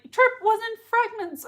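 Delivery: high, lightly sobbing Gemma voice; a hint of her crying to Skye. Quoting Gemma crying to Skye, lightly tearful. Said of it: "she's like".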